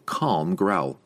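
plain reading voice

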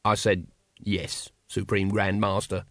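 a hint of a frightened underling murmuring; whispered. A narrator's reading. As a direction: barely voice the answer, cowed. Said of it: "whispered Brother Plasterer".

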